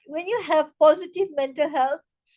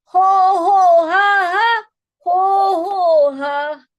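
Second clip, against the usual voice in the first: loud, slow "ho ho ha ha"; slow, forceful staged belly laugh; laughter yoga chant; 5 semitones higher, 8 dB louder, much slower than usual. Shown as a demonstration. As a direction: lead a clapping chant, high and drawn out.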